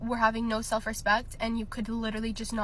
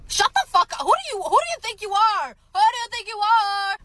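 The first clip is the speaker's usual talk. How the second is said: much higher and louder, outraged yelling; angry shout; 11 semitones higher, 9 dB louder than usual. Real, not acted. Girl yelling at her ex's memory in outrage. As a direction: blast him with fury. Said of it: "outburst at the remembered boyfriend".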